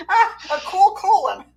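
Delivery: high, loud and slowed, giggles breaking in; real corpsing; laughing retry. Real, not acted. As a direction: fight the laughter to get the phrase out. Retrying the botched phrase, laughing.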